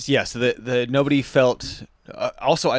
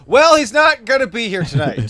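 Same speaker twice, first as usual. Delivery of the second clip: host announcement; 11 semitones higher, 8 dB louder than usual. Retold or imitated. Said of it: "And then he goes".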